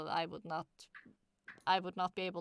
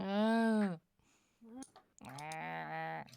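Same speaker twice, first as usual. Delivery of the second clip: goat bleat; 4 semitones lower than usual. Pretend. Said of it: "Starting to sound like a goat".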